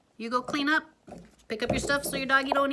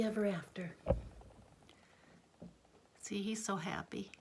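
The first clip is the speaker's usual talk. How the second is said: soft whisper; 3 semitones lower, 13 dB quieter than usual. Real, not acted. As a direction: hush as the story ends. Whispered ending to child.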